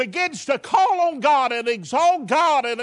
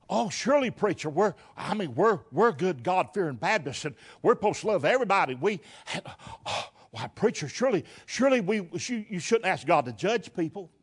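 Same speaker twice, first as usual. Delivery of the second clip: mock churchgoer; 5 semitones lower, 4 dB quieter than usual. Mocking churchgoers' objection to preacher.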